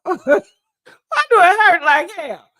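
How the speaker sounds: slow, loud question through laughter; laughing wail. Real, not acted. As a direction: crack up while drawing out the question. Cracking up at own joke.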